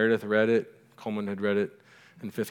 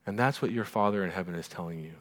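usual speaking voice

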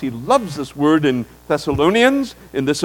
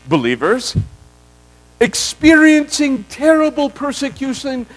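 voicing Paul; 5 semitones higher than usual. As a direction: speak as Paul consoling the believers. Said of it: "what he is saying is this".

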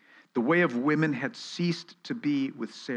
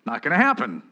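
dry sneer with a sudden high squeak on Me; mock it as impossible; scoffing disbelief; 4 semitones higher, 6 dB louder than usual. Sarah scoffing at the promise, mocking disbelief. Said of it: "was she laughing the laugh of unbelief? It's like".

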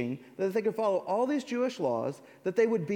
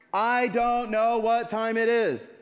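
1 slow loud proclamation; 5 semitones higher, 6 dB louder than usual. Pretend. Note written on windshield.

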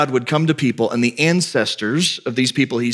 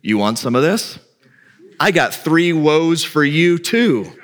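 Jesus answering back; 5 semitones higher than usual. Retold or imitated. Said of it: "guess what he had for them".

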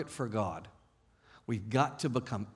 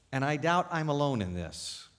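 usual speaking voice

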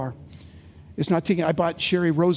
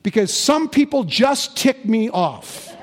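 louder, slow, booming heavenly voice; 5 semitones higher, 5 dB louder than usual. Acted. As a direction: deliver the punchline like God, slow and loud. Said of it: "a voice from heaven saying".

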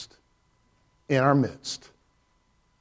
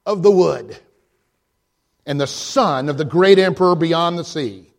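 higher and louder, building to a ringing climax; stern, rising proclamation; 7 semitones higher, 5 dB louder than usual. A narrator's reading. Mister Beaver sternly telling Lucy who Aslan is, building to a loud climax. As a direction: declare it like a proclamation. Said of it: "said sternly".

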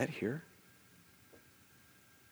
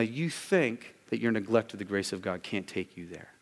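ordinary delivery

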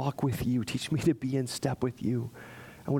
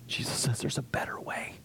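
whisper; 6 dB quieter than usual. Real, not acted. Preacher drops to a whisper.

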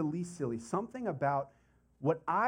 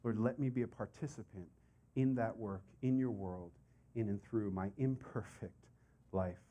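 quieter and lower, a prayer offered with a raised hand; soft surrendered prayer; 8 semitones lower, 6 dB quieter than usual. Pretend. Preacher voices a listener's humble prayer, softly. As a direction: pray it softly, sinking lower to the end. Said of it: "raising the hand and saying".

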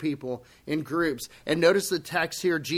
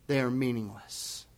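ordinary talk, nothing marked

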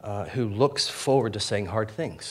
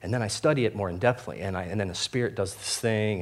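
ordinary talk, nothing marked

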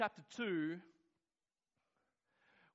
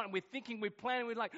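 ordinary talk

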